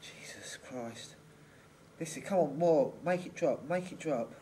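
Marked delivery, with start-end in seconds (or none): 0.0-1.1 breathy whisper. Real, not acted. Shocked by icy arm.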